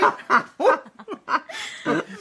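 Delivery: a high giggling cackle; drunken high laugh. Acted. Tipsy father-in-law laughing over punch, high giggle. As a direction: laugh tipsily.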